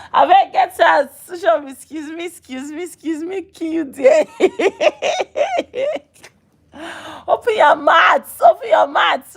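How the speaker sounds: hammed-up outraged woman; mock outrage. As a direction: tell him off, half laughing. Acted confrontation with the man.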